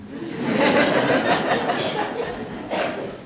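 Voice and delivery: a real burst of high laughter; laughing out loud. Real, not acted. At the eulogy, laughing at the paramedic's line. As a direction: laugh freely at the paramedic's joke.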